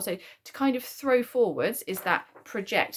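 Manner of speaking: ordinary talk, nothing marked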